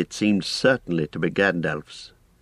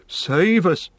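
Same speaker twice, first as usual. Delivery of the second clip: high-pitched gasp of alarm; frightened cry; 11 semitones higher, 4 dB louder than usual. Acted. Innkeeper hears the name Mordor, frightened cry. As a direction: exclaim in dread. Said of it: "cried Mister Butterbur, turning pale".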